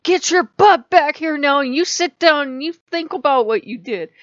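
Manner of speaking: a parent scolding naughty gods, loud; scolding yell. Retold or imitated. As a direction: yell at them like a furious parent. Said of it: "yelling at them and going".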